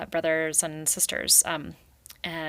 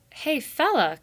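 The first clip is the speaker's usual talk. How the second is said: retort; 9 semitones higher, 5 dB louder than usual. Acted. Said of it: "Clara retorted".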